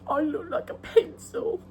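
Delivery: high, laughing-crying whine; Fleabag quote. Retold or imitated. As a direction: play the tearful complaint for laughs. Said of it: "She goes".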